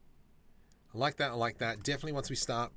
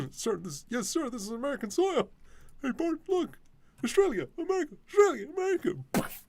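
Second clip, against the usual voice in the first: much higher, louder cartoon voices, hammed up; Simpsons impression; 14 semitones higher, 8 dB louder, faster than usual. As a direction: belt out the cartoon scene like the episode. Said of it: "One of the best episodes of The Simpsons".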